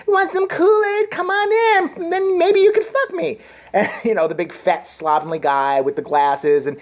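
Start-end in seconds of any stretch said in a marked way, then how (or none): 0.1-3.4 put-on camp it up as a teen decoy luring him in; hammed-up high girly voice. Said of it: "the little nineteen-year-old fake model come and be like".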